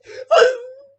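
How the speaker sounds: loud, high fake sob; mock crying wail. Commentator making fun of a streamer's breakdown, loud mock wail. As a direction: wail like a hammed-up crybaby. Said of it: "making fun of his mental breakdown".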